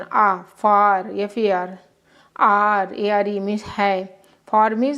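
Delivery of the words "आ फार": drawn-out classroom model of फार; slow teaching demo. Shown as a demonstration. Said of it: "ए का आ का उच्चारण".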